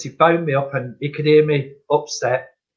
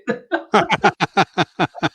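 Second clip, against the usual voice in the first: long, helpless chuckling; real laugh; 3 semitones higher than usual. Real, not acted. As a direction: laugh heartily at your own joke. Laughs at own joke.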